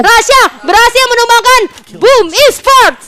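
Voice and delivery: high, loud, slow caster call; hype esports call. Real, not acted. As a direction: call the play like a finale. Hyped play-by-play call. (komentator esports heboh)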